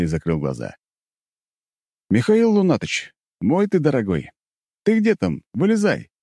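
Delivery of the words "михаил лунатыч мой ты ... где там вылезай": high cheerful call to a friend. Acted. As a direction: coax him out, bright and high. Summoning his bear companion.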